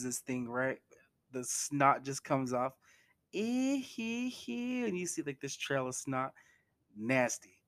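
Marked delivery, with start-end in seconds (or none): 3.3-4.8 slow, high-pitched "ee hee hee"; put-on squeaky hee-hee; imitated high giggle. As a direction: squeal out a drawn-out mock titter. Imitates character's reaction to the kiss.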